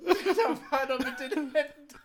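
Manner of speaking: words breaking into laughter; laughing through words. Real, not acted. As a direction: say it while cracking up. Repeats quote while laughing helplessly.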